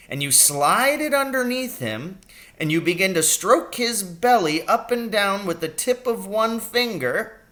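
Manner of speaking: a brisk country doctor fussing over his patient; little old doctor voice. A narrator's reading. Doctor asking his injured patient about the pain. Doctor explains trout tickling.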